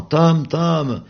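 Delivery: high, loud call across the hill; calling the dog. Acted. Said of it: "en appelant". (appel aigu et fort au chien)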